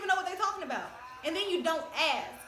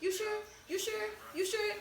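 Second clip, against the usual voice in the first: higher, repeated nagging question; mimicked nagging; 5 semitones higher, 4 dB quieter than usual. Someone asking her one more time, mimicked nagging. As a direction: pester with identical rising questions. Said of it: "if you".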